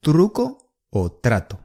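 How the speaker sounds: Truco o trato with tapped r; careful Truco o trato; 西语示范; Spanish demo. Shown as a demonstration. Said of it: "有点难发音啊".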